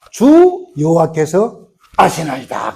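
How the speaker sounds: very slow reverent reply; reverent answer. Acted. Ezekiel answering God, in a slow reverent voice. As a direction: draw the answer out humbly.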